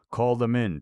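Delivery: loud, high call. Acted. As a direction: call them in loudly. Grushenka calling out.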